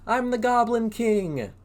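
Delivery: high, bright proud goblin boast. Acted. Reading to kids, Goblin boasts in a high voice. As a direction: show off the crown, high and grand.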